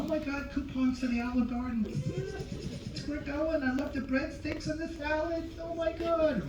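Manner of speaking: soft, high falsetto gushing; excited wife. Acted. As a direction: squeal with delight as an imitated wife. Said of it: "Look at Lois. I know, Lois".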